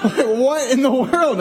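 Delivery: high, slow mock outrage; shocked disbelief. Dad hears Siri's wrong answer, exaggerated shock.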